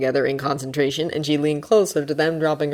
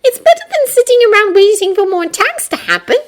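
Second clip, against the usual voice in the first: put-on high girl voice, reasoning; Hermione voice; 8 dB louder than usual. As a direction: lay out the logic.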